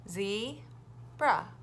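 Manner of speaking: "Zebra" drawn out in two beats; teacher counting syllables with the class. Shown as a demonstration. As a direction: split "Zebra" into two slow parts.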